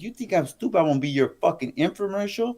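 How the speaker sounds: high, indignant imitation of her rant; mock jealous outrage; retold anger. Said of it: "she was so mad".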